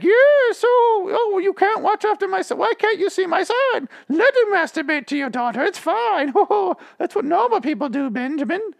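very high falsetto mother impression; boy badly posing as his own mom; fake mom voice. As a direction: put on a squeaky, hammed-up mom voice. Imagines Tate posing as his mom.

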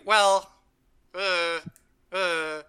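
drawn-out, awkward mock hemming; reluctant hesitation. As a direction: stall with long pauses and trailing noises. Everyone reluctant to answer, acted.

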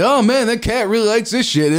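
high, loud goofy stoner; stoned guy voice. Acted. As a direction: squeal it like an amazed stoner. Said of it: "And they're like".

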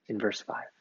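ordinary talk

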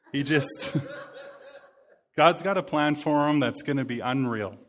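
chuckling while you say it; laughing. Real, not acted. Pastor laughing fondly about his stubborn son.

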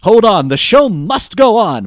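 loud, high theatrical announcement; mock-grand stage cry; showman's declaration. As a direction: proclaim it like a hammy performer. Voicing the showman character advertising.

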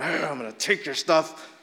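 lightly voiced menacing raider; mock tough-guy threat. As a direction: play a threatening thug. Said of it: "as opposed to".

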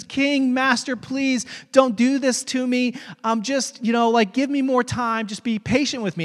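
high-pitched mock begging; acted pleading. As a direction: plead like a debtor before his master. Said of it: "he begs and he pleads".

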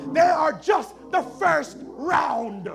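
loud, slow shouted warning; shouted slowly. Acted. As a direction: shout each word slowly. First take, shouted slow.

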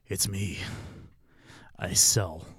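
low, smooth radio DJ voice; lightly put-on radio announcer. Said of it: "slip into your radio voice".

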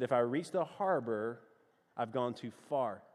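put-on low Southern accent with full R's; puzzled Southerner echoing in his own Southern accent. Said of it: "in my best, uh, Southern accent".

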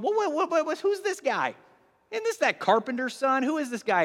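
baffled onlooker. Retold or imitated. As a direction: play a puzzled bystander stumbling over the question. Puzzled crowd voiced in story.

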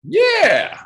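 high excited yeah. Real, not acted. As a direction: cheer it up high. Excited agreement.

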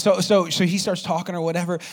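ordinary talk, nothing marked

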